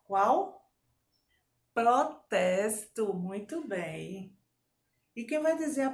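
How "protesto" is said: slow, louder "Protesto"; syllable-by-syllable dictation. Shown as a demonstration. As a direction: separate the syllables of "Protesto" firmly. Teacher divides word into syllables.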